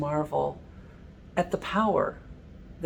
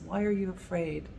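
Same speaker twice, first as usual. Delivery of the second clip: softer, hushed comfort; tender reassurance; 10 dB quieter than usual. Retold or imitated. Jesus reassuring the congregation, soft tender reassurance. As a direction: soothe frightened loved ones. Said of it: "remember Jesus saying to us".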